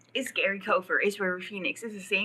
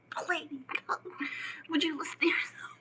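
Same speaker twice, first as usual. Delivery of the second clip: mock whisper; 8 semitones higher, 5 dB quieter than usual. Mocking the audience's words.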